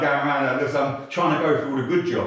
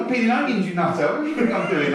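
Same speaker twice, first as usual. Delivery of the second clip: high, louder mock exasperation; exasperated comeback; 9 semitones higher, 4 dB louder than usual. Son answering his mum on a visit, comically exasperated. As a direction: answer back as if it's obvious. Retold snapping back at mum.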